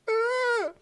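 very high whimpering "Ah"; crying whimper. Retold or imitated. Slapped kid holding his face, high whimper. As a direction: let out a hurt kid's whimper. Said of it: "Just sat there and went".

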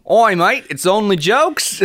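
hammed-up Australian accent; bad Australian accent. Podcast host hailing a mate, bad Australian accent. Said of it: "Australians 'cause I can't do a good accent".

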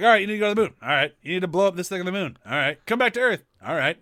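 a gung-ho captain rattling off commands; mock heroic captain giving orders; can-do captain. Voicing the can-do movie captain.

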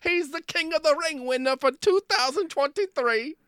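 mock British accent. Host riffing on King Charles in a silly British accent. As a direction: ham up a posh Brit. Continuing British impressions bit.

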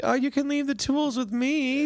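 bright offer in a high voice; mock Kelly. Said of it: "she's like".

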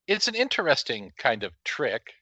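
usual speaking voice

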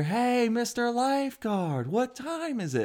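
put-on high, slow, sing-song kid calling out; kid's voice. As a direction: call out cheekily like a kid. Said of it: "you hear".

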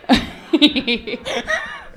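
amused snort through the nose; quick laughing snort. Real, not acted. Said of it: "Did you just snort?".

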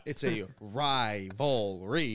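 rivalry stretched out slowly, each syllable drawn. Shown as a demonstration. Presenting the word to friends, slow and drawn out. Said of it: "Here, ladies and gentlemen".

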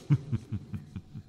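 whispered, airy villain's laugh; breathy sinister laugh. Acted. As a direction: let out a hushed ghostly laugh. Ghost host laughs at trapped guests.

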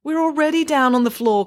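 high-pitched whiny complaint; complaining. A narrator's reading. Boy squashed on the bank floor, complaining. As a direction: complain at being told needlessly. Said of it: "complained Tom".

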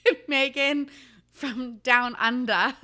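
put-on a laughing, half-committed try at an Australian accent; half-hearted Australian accent. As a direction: slip into a shy Australian accent while laughing. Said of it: "I should do this in an accent".

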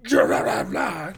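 loud, garbled burst of pretend hate; spat-out pretend venom; mock hateful rant. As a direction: blurt nonsense syllables like a hateful insult. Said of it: "say it with hate behind it".

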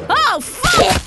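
pained yell. Acted. Stabbed in the game.

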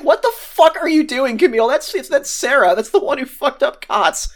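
high-pitched incredulous scolding; retold alarmed objection. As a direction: imitate someone appalled at a friend.

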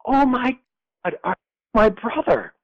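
imitated scream, very high, slowing on the question; mock scream. Sister screams into the phone at her brother, retold high scream. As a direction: cry it out high as her shocked outburst. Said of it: "she screamed into the phone again".

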